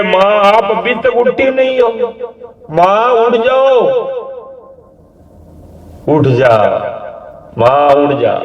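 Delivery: high, insistent pleading calls; calling out. Acted. Son begging his unmoving mother to get up, high and urgent.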